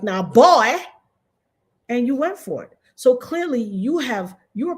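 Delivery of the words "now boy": louder, condescending master voice; condescending Skip. Retold or imitated. Skip talking down to Shannon, louder condescending voice. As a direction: talk down to him like a boss. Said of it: "He talked to you like".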